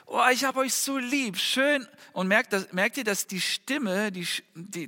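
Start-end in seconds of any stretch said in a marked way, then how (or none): 0.1-1.9 higher, tender "ich hab euch so lieb"; loving voice to kids. Shown as a demonstration. Father telling his kids he loves them, tender and high. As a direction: coo it to your children. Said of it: "Ich werde sagen".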